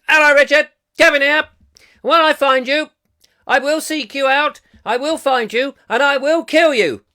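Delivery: high, loud mock caller voice; comically loud, high-pitched funny old bloke; Kevin Hall. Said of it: "Kevin Hall, he's another funny old bloke".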